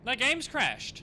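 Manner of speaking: high, loud cry of dismay; real startled exclamation. Real, not acted. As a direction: burst out in surprise, pitch jumping up. Streamer's game suddenly crashes.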